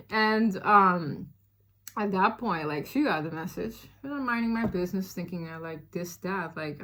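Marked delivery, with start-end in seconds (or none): none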